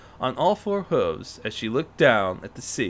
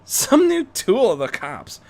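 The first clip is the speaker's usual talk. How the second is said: female Joker; 9 semitones higher, 5 dB louder than usual. Acted. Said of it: "one of the female Jokers laughed".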